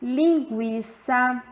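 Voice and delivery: Linguiça said slowly to show the U glide; slow demo word. Shown as a demonstration. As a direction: stretch out Linguiça. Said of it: "quando o U semivogal". (Linguiça dita devagar)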